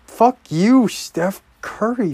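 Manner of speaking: furious fan yelling at the screen; angry shout. Real, not acted. Raging at the game on TV.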